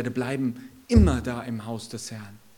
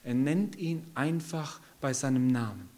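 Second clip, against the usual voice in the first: normal talking voice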